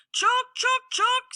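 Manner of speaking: high chattering squirrel scold; sharp high squirrel chuks. Acted. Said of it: "said one hundred more".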